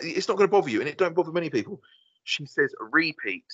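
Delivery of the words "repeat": "repeat" as ree-peat, long first syllable; wife's mispronunciation. Retold or imitated. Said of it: "she can't say repeat".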